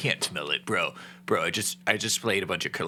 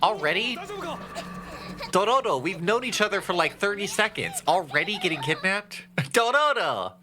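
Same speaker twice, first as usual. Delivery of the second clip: excited; 11 semitones higher than usual. Real, not acted. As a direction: yell the name in delighted disbelief. Teasing the kidnapped game companion.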